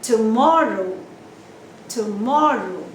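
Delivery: Tomorrow with loud, long MOR; second syllable stress. Shown as a demonstration. Teacher repeats for learners, loud stress on MOR in Tomorrow. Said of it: "On the second syllable".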